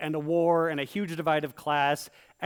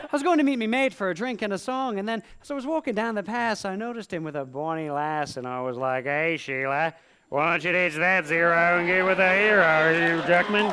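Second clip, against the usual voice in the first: hammed-up Aussie-Irish mashup, louder at the end; comic Aussie accent demo; bad Australian accent; 3 dB louder than usual. Shown as a demonstration. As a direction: mangle an Australian accent for laughs. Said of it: "A demonstration? Of course".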